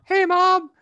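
put-on very high, slow kid voice; mock child voice, high and loud; small boy. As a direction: pipe it up like an innocent little boy. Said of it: "I go up to my mom like".

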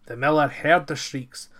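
ordinary delivery

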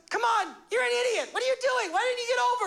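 hammed-up Holy Spirit shouting in disbelief; mock scolding shout. Holy Spirit yells at the driver who crashed, mock exasperated shout.

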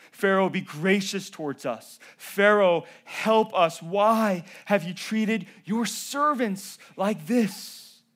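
loud, high begging cry to Pharaoh; preacher voicing Israel's pleading; desperate plea. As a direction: beg Pharaoh for mercy.

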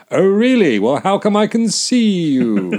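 loud, high, hammed-up disbelief; hammy sceptical retort; mock incredulous comeback. Acted comeback from radio comedy.

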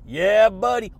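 loud, hyped cheer; loud catchphrase. Real, not acted. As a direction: shout it like a hype man. Shouted catchphrase.

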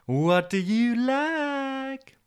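very high, stretched-out question; Jennifer. Acted. TV Jennifer asking Toby's tastes, high coaxing voice. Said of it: "she'd say".

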